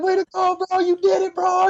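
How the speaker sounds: very high mock cheer. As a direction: hype him up. Crowd congratulating him.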